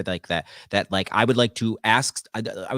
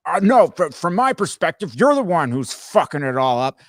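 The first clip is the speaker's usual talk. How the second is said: mock indignation, higher and louder; indignant objection; 7 semitones higher, 6 dB louder than usual. One side blaming the other in an argument, indignant. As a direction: push back hard, blaming the other side. Acted argument between opposed brains.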